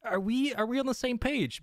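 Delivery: incredulous plea. Retold or imitated. Pleading rhetorical question to listener.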